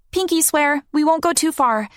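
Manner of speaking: put-on high child's promise; a kid promising earnestly; young girl voice. Child voice in story.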